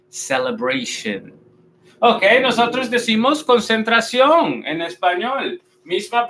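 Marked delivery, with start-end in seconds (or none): 0.1-1.4 "Celebration" as "se-le-BREI-shen", slow, soft and low; correct English pronunciation. Shown as a demonstration. Teacher repeats the model for learners, correctly pronounced. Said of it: "Vamos a decir celebration".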